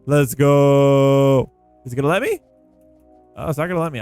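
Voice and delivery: ordinary talk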